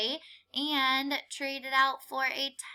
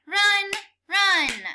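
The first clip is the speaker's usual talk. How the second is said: louder, rising, each run punched; chanted word, getting louder; clapped and called out; 5 semitones higher, 5 dB louder, slower than usual. Shown as a demonstration. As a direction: clap out every run with energy. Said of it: "Clap it".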